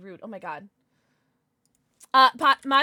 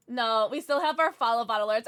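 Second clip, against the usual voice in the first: ordinary talk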